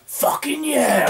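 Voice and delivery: high, drawn-out mock kid whoop; little kid cheering. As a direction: squeal it like an excited eight-year-old. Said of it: "the little kid goes".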